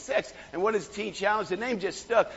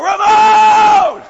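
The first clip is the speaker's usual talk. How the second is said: much higher, very loud cry; excited shout; 12 semitones higher, 14 dB louder, much slower than usual. Real, not acted. Preacher reliving his words to Ramon, straining excited shout. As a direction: cry out the love till the voice cracks. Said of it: "you get me excited over there".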